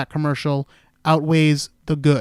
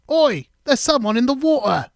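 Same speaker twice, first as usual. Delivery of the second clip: put-on a raised, higher British kid's call; imitating a London kid calling to the others; kid yelling, British accent; 9 semitones higher, 5 dB louder than usual. Host imitates the kid shouting from the PSA, British accent. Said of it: "one of the kids yells out".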